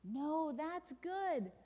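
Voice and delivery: very slow, high lilting contradiction; reassuring correction. Acted. Storyteller overturns bad news, slow and reassuring. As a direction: stretch out the good news.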